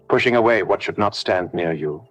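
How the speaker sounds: ordinary talk, nothing marked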